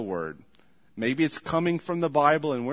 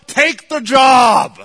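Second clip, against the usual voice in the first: slow, much louder shouted order; emphatic command; 9 semitones higher, 15 dB louder, much slower than usual. Real, not acted. Pastor ordering idle listeners to work, slow shout. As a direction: shout each word at them.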